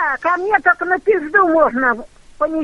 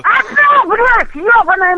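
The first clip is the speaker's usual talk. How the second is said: furious outburst, raised voice; angry shout; 3 semitones higher, 3 dB louder than usual. Real, not acted. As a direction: snap back in a rage. Resident yells at prank caller. (злой крик)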